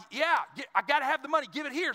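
high-pitched, frantic retold demand; impatient demand. Boy agreeing to his friend's loan terms, impatient. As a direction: act out a desperate kid grabbing for cash.

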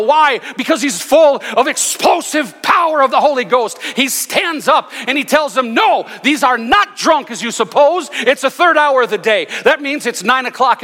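high, loud, building sermon; full-force preaching. Acted. Preaching Peter's Pentecost sermon.

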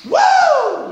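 high burst of joy; joyful whoop. Shown as a demonstration. Preacher showing how joy breaks out, joyful whoop. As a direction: whoop suddenly, far above speaking pitch. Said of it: "joy sometimes just goes".

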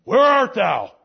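loud, high, harsh demanding call; angry God. Shown as a demonstration. God confronting the hiding Adam, loud and harsh. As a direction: thunder it as an accusation. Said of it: "Does it sound better to you?".

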